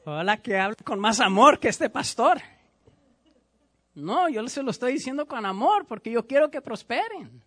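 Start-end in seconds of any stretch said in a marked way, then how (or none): 0.0-2.4 grumbling churchgoer. Pretend. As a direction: grumble like a member who dislikes the sermon. Pastor voices a displeased churchgoer.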